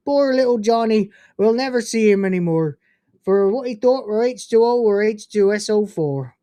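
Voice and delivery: hammed-up Cornish farmer reciting; Cornish accent. Shown as a demonstration. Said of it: "in a Cornish accent".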